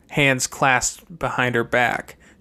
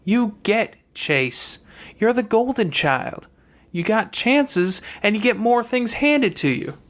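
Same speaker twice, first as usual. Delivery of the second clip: higher sister's voice, cool and matter-of-fact; a younger sister laying out hard truths, voiced higher; Fernanda's voice; 9 semitones higher than usual. Fernanda explains her place to brother Chase, higher sister voice.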